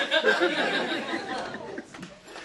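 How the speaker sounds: an airy laugh way up high; high breathy laugh. Real, not acted. Comic laughing at the preacher's rambling.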